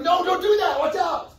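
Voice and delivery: alarmed cry of warning; warning shout. Pretend. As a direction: yell it to stop a friend doing something dumb. Calling out to stop someone.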